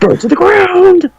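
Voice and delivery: very high-pitched, hammed-up quote; mock game-line cry. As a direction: squeal it like a silly meme line. Mimicking a memed game line.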